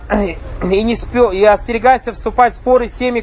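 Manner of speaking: ordinary talk, nothing marked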